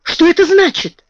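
high, loud shocked demand. Acted. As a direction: blurt it out in alarm. Shocked headmistress demands explanation.

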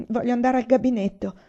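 a touch of forced firmness; as firm as possible. A narrator's reading. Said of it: "con la voce più ferma che riuscì ad articolare".

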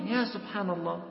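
normal talking voice

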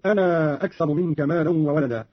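أنا with a long held final a, "anaaa"; separated madd. Shown as a demonstration. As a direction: stretch the last vowel of أنا well before أكثر. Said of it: "يصير عنده مدا منفصلا".

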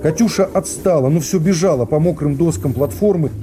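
ordinary talk

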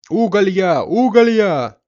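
high, loud bellowed street cry; coal seller's cry. A narrator's reading. Coal seller in the yard calling out, high loud cry. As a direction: holler coal to the whole street. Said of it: "раздался со двора в пять голосов".

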